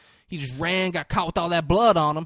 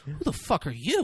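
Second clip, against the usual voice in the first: retort; 6 dB quieter than usual. Retold or imitated. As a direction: throw the question back quietly, amused. Retold lawyer answers the bully back.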